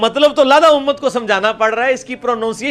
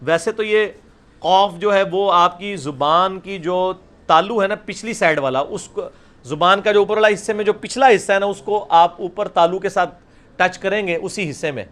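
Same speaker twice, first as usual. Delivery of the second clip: normal talking voice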